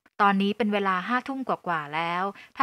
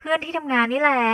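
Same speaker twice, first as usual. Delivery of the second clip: mildly irritated, raised-voice answer; annoyed brush-off; 7 semitones higher, 6 dB louder than usual. Acted. Sister cutting short her brother's questions, annoyed. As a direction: answer just to end the nagging. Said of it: "หมิงตอบยังตัดความรำคาญ".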